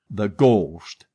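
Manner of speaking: ordinary delivery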